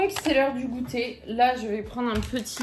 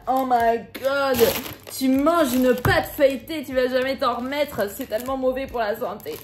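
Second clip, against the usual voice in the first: mocking commenters; 2 semitones higher, 6 dB louder than usual. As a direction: ham up the horrified health nuts. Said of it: "il y a les gens qui étaient là". (imitation moqueuse et outrée, lente et forte)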